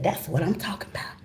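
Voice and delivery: breathy eager whisper. Retold or imitated. Husband excited about dessert, whispering eagerly. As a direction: whisper it with anticipation.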